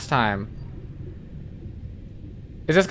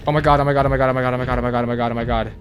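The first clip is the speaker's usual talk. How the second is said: panicked; much faster than usual. Real, not acted. Panicked chant near lava.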